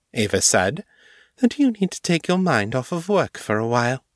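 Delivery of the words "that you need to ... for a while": high, poised mistress. A narrator's reading. Mistress advising Morgan to rest, high and poised. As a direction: pitch it high, with authority. Narrator voices Ava, the mistress.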